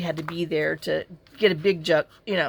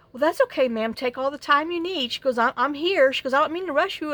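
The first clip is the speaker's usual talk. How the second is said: sweet agent voice; 7 semitones higher than usual. Retold or imitated. Voicing the polite phone agent.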